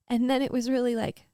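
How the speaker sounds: ordinary talk, nothing marked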